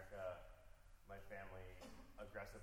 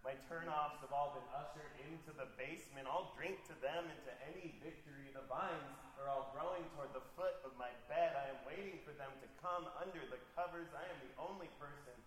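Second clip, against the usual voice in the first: measured, louder performance voice for a poem; poetry reading; 5 semitones higher, 9 dB louder than usual. Acted. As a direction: read each line like it matters on stage. Reads her poem aloud.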